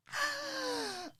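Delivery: high shocked intake of breath; sharp gasp. Retold or imitated. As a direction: gasp in horror. Shocked gasp in retold story.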